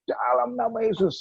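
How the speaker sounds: high, drawn-out mock yell; sustained high call at normal volume; retold shout. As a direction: re-enact crying out the holy name. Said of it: "saya teriak-teriak".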